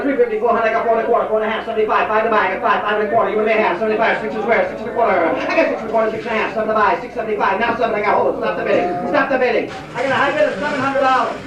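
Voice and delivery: rattling telephone-auction pitchman patter; rolling, unbroken auction chant on a steady note; auctioneer. Acted. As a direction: run it like an auctioneer calling bids. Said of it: "Chuck would keep going".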